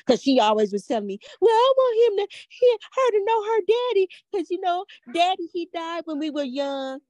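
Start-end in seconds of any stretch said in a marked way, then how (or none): 1.4-7.0 high, mocking imitation of a daughter; mimicked daughter. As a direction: quote her excuse in a much higher voice. Mimics daughter's excuse in argument.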